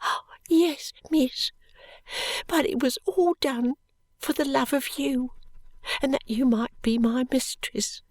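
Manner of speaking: gentle and devoted; shy little maid. A narrator's reading. Charlie telling Esther it was for her love, whispered.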